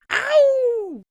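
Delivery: a sudden high pained "Ow!"; sharp yelp. Retold or imitated. Pastor hit from behind, yelping in pain.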